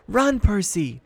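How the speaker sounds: loud, high urgent cry; shouted. A narrator's reading. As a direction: make him flee. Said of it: "voice shouted".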